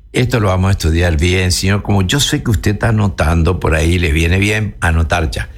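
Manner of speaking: ordinary talk, nothing marked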